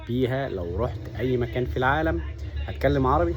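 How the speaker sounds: ordinary delivery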